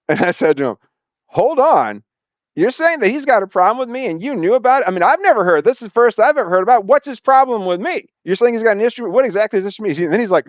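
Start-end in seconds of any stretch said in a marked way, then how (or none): 1.3-9.9 indignant confrontation. Retold or imitated.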